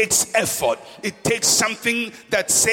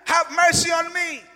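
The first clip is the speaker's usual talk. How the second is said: shouting for mercy; 10 semitones higher than usual. Acted. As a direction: shout over the crowd for mercy. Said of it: "but he's shouting".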